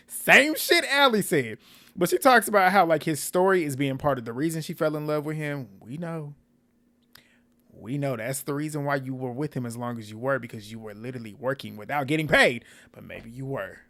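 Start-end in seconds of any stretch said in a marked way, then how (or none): 0.1-1.2 high, loud, drawn-out exclamation; exclaimed reaction. Real, not acted. Recapper reacting to the bride copying Allie, loud high exclamation. Mocking reaction to a repeat wedding outcome. 12.9-13.8 low, soft afterthought; quiet musing. Real, not acted. Recapper wondering whether she was paid, quiet musing. Repeats the thought with more force.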